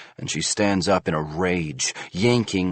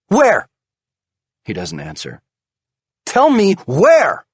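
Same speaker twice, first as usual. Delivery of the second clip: furious demand; 11 semitones higher, 8 dB louder than usual. A narrator's reading. Wife demands where husband cheated, furious. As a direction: demand it, stamping with rage.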